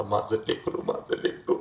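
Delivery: hushed imitation of a fretting dad; father's fond fret. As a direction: murmur it like a worried father over a child. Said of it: "रोज तुझ्या आईला ओरडत होता".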